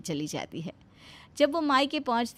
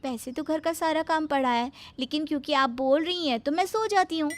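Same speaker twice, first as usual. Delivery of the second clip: sweet bahu; 7 semitones higher than usual. Acted.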